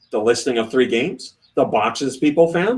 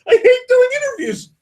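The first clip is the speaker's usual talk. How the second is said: very high, hammed-up frustration; mock exasperated wail; 3 dB louder than usual. Designer fed up with joint interviews, mock exasperated wail. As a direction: cry it out as a comic, fed-up quote.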